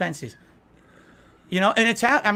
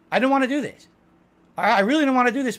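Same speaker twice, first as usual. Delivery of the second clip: reluctant outburst; 7 semitones higher, 4 dB louder, faster than usual. Real, not acted. Reluctant, voice rising.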